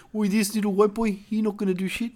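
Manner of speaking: lightly voiced Kiwi drinker; a touch of a Maori accent. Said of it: "They were like".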